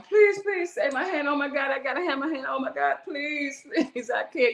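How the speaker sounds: high, pleading cry for help; retold begging. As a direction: beg them desperately to save the hand. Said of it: "I'm begging them".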